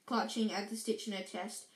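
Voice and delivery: plain reading voice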